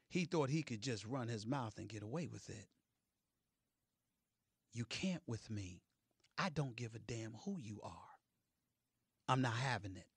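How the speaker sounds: low, hushed, deliberate warning; quiet warning. Real, not acted. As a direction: drop your voice right down and lay down the law. Stern warning after the shouting.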